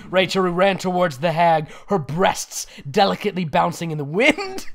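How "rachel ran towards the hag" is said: ordinary delivery, nothing marked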